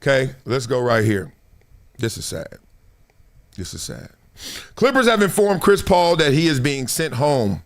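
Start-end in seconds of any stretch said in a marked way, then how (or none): 2.0-2.6 a dismayed, low remark. Real, not acted. Reacting to sad Clippers news. 3.6-4.1 softer, sinking repeat; sadder and softer. Real, not acted.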